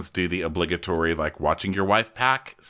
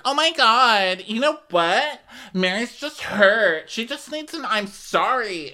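high, slow, drawn-out whine of concern; mock gushing plea; 14 semitones higher, slower than usual. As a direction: plead on her behalf, high and stretched out. Acting out friend hugging Mary.

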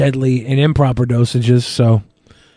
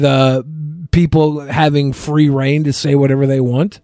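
normal talking voice

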